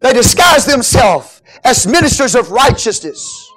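loud, high, slow proclamation; preach. Acted. Paul denouncing false ministers, full-force preaching. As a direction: belt it out, stretching the key words.

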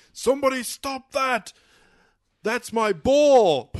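loud, high shout in a bad Austrian accent; awful Schwarzenegger accent. Podcaster imitating an action star's film line, awful accent, shouted. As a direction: bellow it in a hammed-up Arnold accent, dragging the last words. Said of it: "I don't know why that accent was awful".

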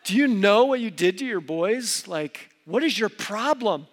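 acted angry wife telling off her husband; wrong-way confrontation. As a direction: play an accusing, fed-up spouse. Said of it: "the wrong way to confront me".

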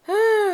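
high, audible exhale of relief; relieved sigh. Retold or imitated. Cat safely back indoors, high relieved sigh. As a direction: sigh as if it's all over.

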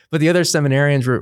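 ordinary talk

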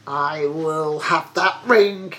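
slow, drawn-out villain's vow; scheming old sorcerer. A narrator's reading. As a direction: vow it slowly to yourself. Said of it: "said he to himself".

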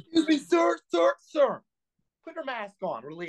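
squeaky high-pitched officious guard voice; high mock official. Friend mocking a mask-enforcing guard, in a squeaky officious voice. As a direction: whine it up high like a petty enforcer.

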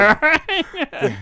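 mimicking the old man. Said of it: "mimicking the retiring teacher".

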